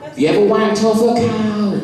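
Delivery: very high put-on Somerset questioning; high, mocking West Country question; mock Somerset accent. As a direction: tease with a hammed-up Somerset accent. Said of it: "always in that accent".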